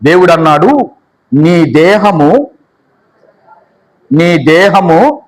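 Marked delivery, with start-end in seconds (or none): 1.3-5.2 dehamu drawn out slowly, rising at the end; slow recitation. Shown as a demonstration. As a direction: spell out నీ దేహము slowly for them to repeat. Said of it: "చెప్పాలి". (నెమ్మదిగా, స్పష్టంగా)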